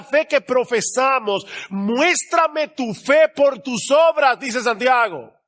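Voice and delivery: high, slow, drawn-out exhortation; full-force preaching. Real, not acted. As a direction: drive each phrase home from the pulpit.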